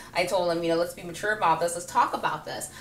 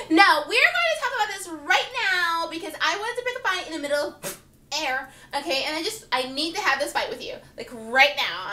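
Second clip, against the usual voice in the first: pushy ex; 7 semitones higher than usual. Retold or imitated. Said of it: "one ex who'd be like".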